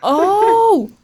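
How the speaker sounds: excited. Real, not acted. Excited discovery.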